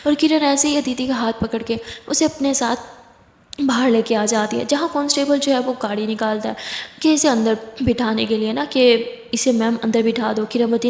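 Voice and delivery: usual speaking voice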